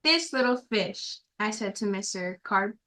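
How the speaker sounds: usual speaking voice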